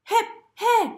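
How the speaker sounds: appel aigu et fort; grenouille qui crie. A narrator's reading. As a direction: crier pour qu'on l'attende. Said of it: "crie une grenouille". (cri aigu de grenouille)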